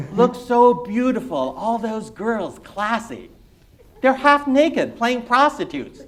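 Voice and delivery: high, slow, loud gushing dad; a father raving about a musical; delighted dad swooning over the show; admiring immigrant father. Acted. Reader voices the immigrant father.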